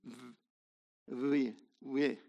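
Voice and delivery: soft buzzing V-W sound, v-, v-; V W sound demo. Shown as a demonstration. Teacher sounds out the German V-W, quiet and drawn. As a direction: buzz the v- between V and W. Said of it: "they still maintain that".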